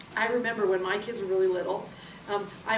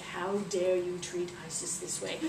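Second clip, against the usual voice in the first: low, softer menacing indignation; mad scorpions; 5 semitones lower, 7 dB quieter than usual. Acted. Scorpions angry at Isis's treatment, low quiet anger. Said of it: "the scorpions are mad".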